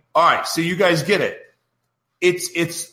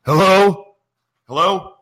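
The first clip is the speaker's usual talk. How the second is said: imitating his own irritated phone greeting, louder on the second hello; a clipped, put-out "hello" to an unscheduled caller; annoyed hello; 4 dB louder than usual. Host imitating himself answering an unscheduled call, irritated. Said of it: "my voice is like".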